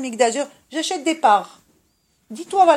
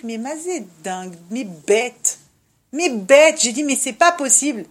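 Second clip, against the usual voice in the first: ordinary delivery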